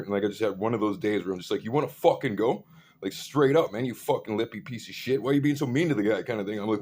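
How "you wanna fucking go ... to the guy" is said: loud, raised, aggressive challenge; retold chewing-out. Speaker chewing out the dude for bullying, angry and loud. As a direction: lay into him, voice up.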